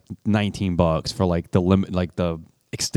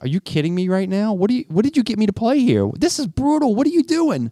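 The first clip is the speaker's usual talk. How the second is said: high, strained disbelief; shocked and pleading, voice way up; incredulous outburst; 14 semitones higher than usual. Retold or imitated. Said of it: "I'm like".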